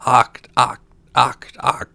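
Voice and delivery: loud, evenly spaced frog cries; croaking frogs. A narrator's reading. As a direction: croak each word separately like a frog. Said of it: "he heard the frogs crying".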